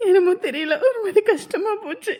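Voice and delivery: choked up, talking through tears; tearful. Acted. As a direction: try to explain while crying. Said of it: "எதுக்குப்பா அழுதுட்டு இருக்க". (அழுதுகொண்டே பேசும் குரல்)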